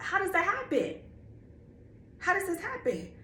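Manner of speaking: high, baffled retold questions; incredulous disbelief. Retelling a talk with God, high stunned questions. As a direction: pitch it way up in disbelief. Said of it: "And I'm like".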